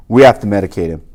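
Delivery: low, loud cop barking an order; cop's order. Retold or imitated. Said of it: "said this one cop".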